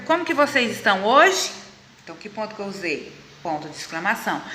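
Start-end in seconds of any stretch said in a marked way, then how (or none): 0.0-1.5 "Como que vocês estão hoje" higher, rising on "hoje"; question intonation. Shown as a demonstration. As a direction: ask it as a clear example question, lifting "hoje". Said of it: "vou fazer uma pergunta". (pergunta de exemplo, com "hoje" subindo)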